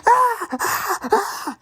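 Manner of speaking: high squealing ah ah ah, mocking a scared cartoon cat; hammed-up high-pitched panic; mock panicked shrieks. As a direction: shriek like a frightened coward. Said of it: "Gumball is like".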